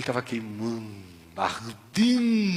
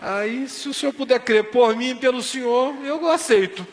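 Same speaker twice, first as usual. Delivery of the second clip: higher and louder, laughing through the words; laughing acceptance; 12 semitones higher, 6 dB louder than usual. Retold or imitated. Seu Barros laughs as he accepts the doctor's faith, laughing. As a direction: chuckle as you give in.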